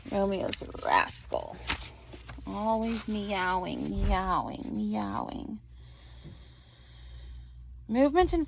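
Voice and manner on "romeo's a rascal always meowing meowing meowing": cooing at the cat. Real, not acted.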